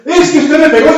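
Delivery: put-on high, loud whiny teen insisting; high and loud, a frightened young man on a motorbike; panicky young biker. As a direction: play the frightened kid who keeps repeating himself.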